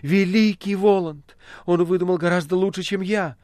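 high, loud acclaim; exultant echoing. A narrator's reading. Margarita echoes the Master praising Woland, exultantly. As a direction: join in the praise fervently. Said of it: "стала вторить ему Маргарита".

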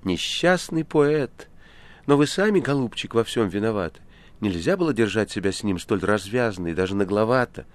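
high, emphatic commiseration; sympathetic. A narrator's reading. Guest consoles the poor poet, sympathetic reproach. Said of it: "Гость сочувственно положил руку".

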